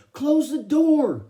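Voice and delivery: high, loud, alarmed shout; urgent yell. A narrator's reading. Kenny bursts in to the family, urgent high yelling. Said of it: "Mom and Dad and Joey all yelled".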